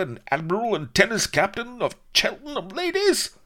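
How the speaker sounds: very high, loud blustering admiral; apoplectic outrage. A narrator's reading. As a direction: splutter with snobbish fury. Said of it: "Rook went purple".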